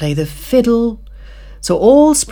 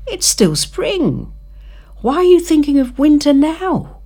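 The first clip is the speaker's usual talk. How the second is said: carefree grasshopper; 12 semitones higher than usual. Acted. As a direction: call out like a happy-go-lucky grasshopper. Grasshopper voiced in story.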